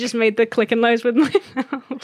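laughing through the words. Real, not acted. Host explaining her clicking noise while laughing. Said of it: "She's giving us all Foley".

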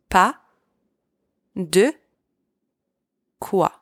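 "Pas de quoi" split into three slow words. Shown as a demonstration. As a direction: drag out "Pas de quoi" word by word. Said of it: "Saying it a bit faster".

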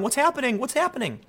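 high, frightened questions; mock-panicked kid asking what's going on; scared. A scared child shaken by adults, asking in a high panic. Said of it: "And then you get scared".

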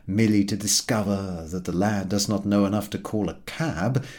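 plain reading voice

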